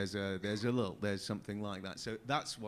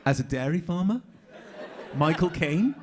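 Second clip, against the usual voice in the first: higher, louder disbelief, played for laughs; mock incredulity; 6 semitones higher, 6 dB louder than usual. Actor to producers about Michael Caine, mock incredulous. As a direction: re-enact being baffled by an absurd request.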